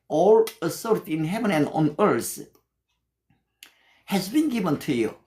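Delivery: slow, deliberate English verse recital; measured English Bible reading; scripture recitation. Shown as a demonstration. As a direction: recite each word slowly like memorized scripture. Pastor recites English verse slowly.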